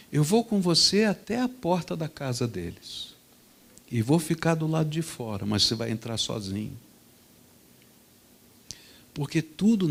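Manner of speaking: low, soft, measured fatherly voice; steady and grave, teaching a lesson; firm father. Retold or imitated. Father telling his son to apologize, calm and firm.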